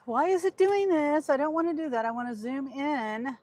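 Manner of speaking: high, loud exasperated complaint; exasperated at the app; frustrated. Real, not acted.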